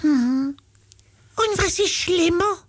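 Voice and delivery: very high falsetto monkey voice; high hum, then a squeaky riddle; Äffle cartoon monkey. Äffle asking what is worse, high monkey voice. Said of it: "Der geht jetzt folgendermaßen".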